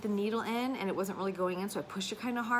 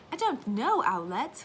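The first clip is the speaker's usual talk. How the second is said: put-on lightly British little boy, higher and rising; child in a light British accent; retelling son's British accent; British toddler; 4 semitones higher, 5 dB louder, slower than usual. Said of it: "one hundred percent British".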